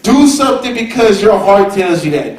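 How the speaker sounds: usual speaking voice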